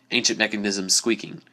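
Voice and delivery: ordinary delivery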